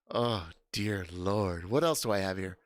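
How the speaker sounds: usual speaking voice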